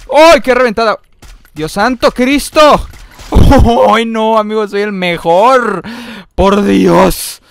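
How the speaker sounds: loud, stretched-out celebration; excited boasting. Real, not acted. Gamer hyping his own kill, excited boasting. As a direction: crow loudly about being the best. Boasting after a great shot.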